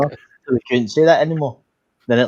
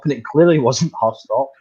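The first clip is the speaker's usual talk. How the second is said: usual speaking voice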